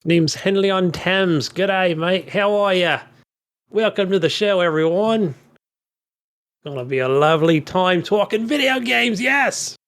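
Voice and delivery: slow, hammed-up Australian show host; mock Australian accent. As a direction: welcome listeners in a put-on Aussie accent. Puts on foreign host persona.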